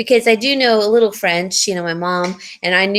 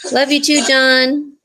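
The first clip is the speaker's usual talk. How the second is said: higher, raised call across the room; calling out affectionately; 6 semitones higher, 4 dB louder than usual. Real, not acted. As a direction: call it out to someone off-mic. Calling out to John off-mic.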